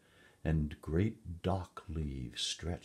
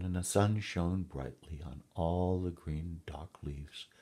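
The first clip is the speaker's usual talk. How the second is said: plain reading voice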